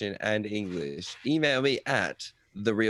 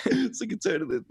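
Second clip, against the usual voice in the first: laughing. Real, not acted. Laughing after retold shock.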